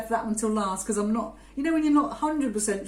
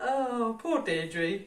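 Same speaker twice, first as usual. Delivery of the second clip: laughing; 3 semitones higher, much slower than usual. Real, not acted. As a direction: drag it out laughing. Giggling at photo.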